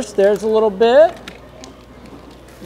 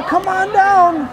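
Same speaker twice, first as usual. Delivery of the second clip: calling out; 8 semitones higher, much slower than usual. Acted. Calling next kids forward.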